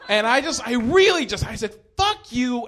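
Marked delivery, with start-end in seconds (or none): 2.0-2.7 high, forceful retold tirade; laying into him. Comic retells cursing out a man, high-pitched tirade. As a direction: tear into him, retelling the outburst. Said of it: "laid into him".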